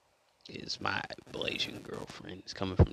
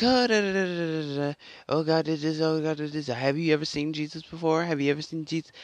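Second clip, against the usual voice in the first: higher-pitched mimicry of pestering questions; mocking imitation; 5 semitones higher than usual. Retelling skeptics' questions about seeing Jesus, mockingly. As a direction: rattle it off in a raised mock voice.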